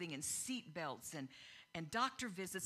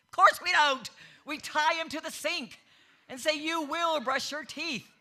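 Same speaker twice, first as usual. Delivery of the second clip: loud, fired-up preaching, much higher and louder; excited; 6 semitones higher, 10 dB louder than usual. Real, not acted. Preacher to parents about church, loud and fired up. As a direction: let the passion boil over into near shouting. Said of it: "I got a little excited there".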